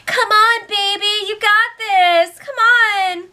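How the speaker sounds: high, loud cheering voice; mock cheerleader. Danielle cheering Jason on, mocked high cheer. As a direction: cheer like an over-the-top fan. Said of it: "she was all like".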